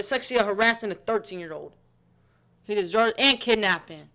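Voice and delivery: loud, furious outburst; angry rant. Real, not acted. Viewer shouting in outrage at the pedophile. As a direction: shout your disgust at him.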